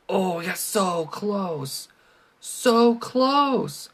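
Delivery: high, slow whine of loss; whiny disappointment. Real, not acted. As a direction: whine it out, so close. Near miss in game.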